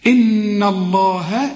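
"الله" with a light, thin l, drawn slow; thin Allah. Shown as a demonstration. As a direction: keep the lam of "الله" thin after the kasra. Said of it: "لفظ الجلالة رقاها".

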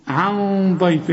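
slow عن ضيف, thick nasal hum on the noon; heavy ghunnah. Shown as a demonstration. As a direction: hold a dark hum in عن before ضيف. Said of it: "هنا الغنة بقى مفخمة". (عن ضيف بغنة مفخمة)

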